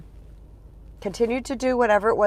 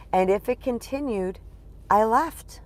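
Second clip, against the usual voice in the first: normal talking voice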